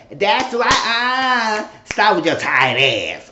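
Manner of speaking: loud, high, drawn-out scolding; old lady scolding. Retold or imitated. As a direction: imitate an old lady telling someone off loudly. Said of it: "that old lady was going".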